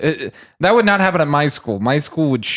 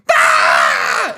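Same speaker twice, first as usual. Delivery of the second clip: sudden loud yell; abrupt freak-out "Ah!"; mock breakdown scream; 9 semitones lower, 4 dB louder than usual. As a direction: snap into a short crazed scream. Acting out psychotic breakdown.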